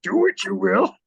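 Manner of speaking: high, slow, mock-wise Yoda hum; Yoda impression. Mocking the co-host's Harry, high slow Yoda voice. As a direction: croon it like tiny old Yoda. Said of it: "Harry's morphed into Yoda now".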